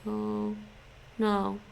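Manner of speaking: ordinary talk, nothing marked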